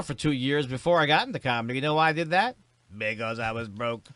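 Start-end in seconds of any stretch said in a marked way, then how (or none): 3.0-4.0 soft throwaway catchphrase; understated tagline. Pretend. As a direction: drop it quietly as the punchline. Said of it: "your catchphrase".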